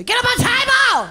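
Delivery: put-on high loud accented command; bossy masseuse order. Masseuse ordering her client onto the table, loud high command. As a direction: snap it like a stern masseuse.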